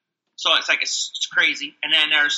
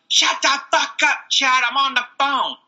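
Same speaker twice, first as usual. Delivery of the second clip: high-pitched, drawn-out coworker's scolding; mock yell; 10 semitones higher than usual. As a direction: imitate a coworker yelling across the room. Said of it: "yelling at me".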